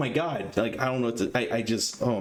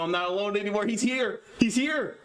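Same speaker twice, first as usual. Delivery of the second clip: higher, giddy announcement; excited; 7 semitones higher than usual. Real, not acted. Streamer tells chat the guest arrived, giddy and high. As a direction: blurt it out, giddy that he's arrived.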